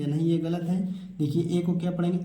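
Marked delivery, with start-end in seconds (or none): none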